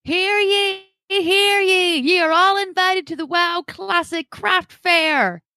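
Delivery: booming proclamation, high and slow; town crier. Pretend. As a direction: herald calling the fair. Podcast intro mock proclamation.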